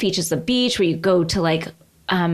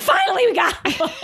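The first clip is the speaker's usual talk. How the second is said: mock excited tour group; 11 semitones higher than usual. Tour people voiced, high pitch.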